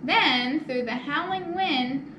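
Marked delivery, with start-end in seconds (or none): none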